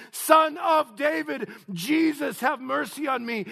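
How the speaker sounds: very high, loud shouted plea; full-voiced, high cry; yelling out. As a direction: yell for mercy across a crowd. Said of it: "would yell out among the crowd".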